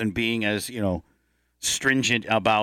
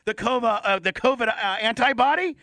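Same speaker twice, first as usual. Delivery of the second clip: Mahomes impression; 9 semitones higher than usual. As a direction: brag like a rich star who just beat covid, pitched way up. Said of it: "then he could be like".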